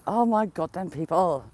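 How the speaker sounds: ordinary delivery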